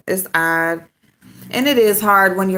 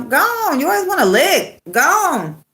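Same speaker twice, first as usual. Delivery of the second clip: higher, playful shooing; shooing the dog; 9 semitones higher, 4 dB louder than usual. Real, not acted. Owner pushing off her licking dog, high and playful. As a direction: push the licking dog away.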